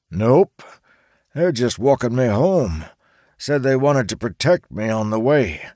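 casual grumpy grandpa; old man voice. Acted. Charles explains.

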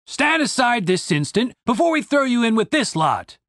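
a hint of a bullying guard; threatening soldier. A narrator's reading. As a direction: threat from a lightly voiced soldier.

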